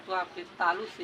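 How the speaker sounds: ordinary talk, nothing marked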